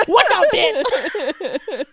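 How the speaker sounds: very slow, swaggering, laughing through it; cocky mock greeting. Imagining swaggering back to the barista, slow and cocky. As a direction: drag it out like a smug pickup line.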